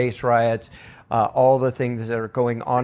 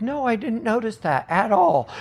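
quoting myself; 10 semitones higher than usual. Retold or imitated. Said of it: "I'm like".

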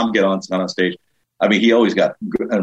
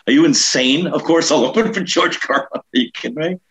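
higher-pitched comic disbelief; mock incredulity; 8 semitones higher than usual. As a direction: act out an incredulous yes to a dream offer. Mock disbelief at agent's offer.

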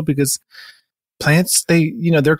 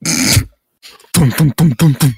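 knocking noise; much slower than usual. Acted. Door knocker sound imitated.

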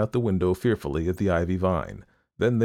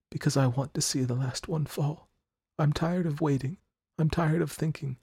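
put-on tired dying girl; 9 semitones higher than usual. A narrator's reading. Sick girl to her friend, longing to let go. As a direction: sigh it out, fading to the end. Johnsy voiced, tired, fading.

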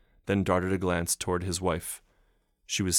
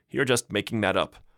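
exasperated; 3 semitones higher, 5 dB louder than usual. Acted. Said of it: "said his wife exasperatedly".

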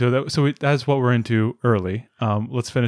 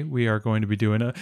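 ordinary delivery

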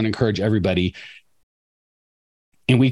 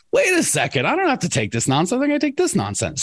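mock sudden realization, jumping much higher; a hammed-up lightbulb moment, high and amazed; 10 semitones higher than usual. Owner finally realizing, mock voiced.

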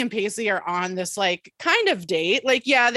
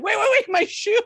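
frantic protest; 11 semitones higher than usual. Retold or imitated. Joey rushed out the door, high frantic protest.

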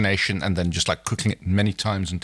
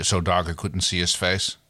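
plain reading voice

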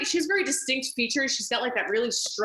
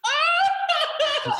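mock shriek. Tennis player realising it's still Jerry, hammed-up shriek. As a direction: burst into a fake high wail. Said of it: "And she's like".

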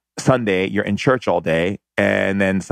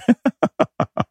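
high, airy burst of laughter; real laughter. Real, not acted. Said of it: "even now I'm laughing".

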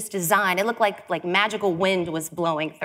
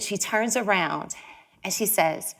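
ordinary delivery

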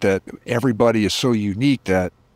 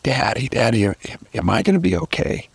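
a hint of a frightened two-year-old, rising higher on "am I gonna be okay"; scared little boy; 5 semitones higher than usual. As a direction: voice the sick toddler asking his dad, pitch climbing at the end. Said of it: "it's like".

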